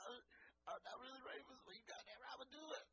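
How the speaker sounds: high, breathy, near tears, voice cracking; excited shaky quote. Retold or imitated. Guest quoted again, high and near tears. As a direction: gasp it out like you might cry. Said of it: "One of the greatest quotes".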